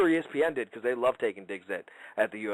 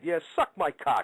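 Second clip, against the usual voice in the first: ordinary talk, nothing marked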